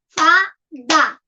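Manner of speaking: "Fada" in two separate pieces, Fa... da; "Fada" split evenly into two syllables. Shown as a demonstration. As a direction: count out "Fada" with a gap between Fa and da. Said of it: "Dois pedacinhos".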